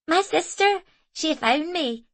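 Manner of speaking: put-on high, loud child's voice, proud; a small boy announcing proudly; confident little boy. A narrator's reading. Small boy proudly telling Zoe his news. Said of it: "he announced so confidently".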